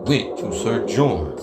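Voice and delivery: ordinary talk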